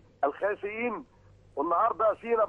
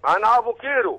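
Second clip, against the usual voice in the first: said again louder and clearer; louder repeat; 2 semitones higher, 6 dB louder than usual. Real, not acted. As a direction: repeat it louder for a bad line. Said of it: "معلش الصوت مش واضح؟".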